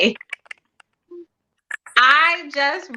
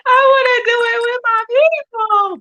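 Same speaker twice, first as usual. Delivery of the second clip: much higher, louder replay of her own eager wish; eager self-quote; 4 dB louder than usual. Retold or imitated. Panelist replaying her own wish to the group, high and eager. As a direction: bounce it up high like you're thrilled all over again. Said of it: "I was like".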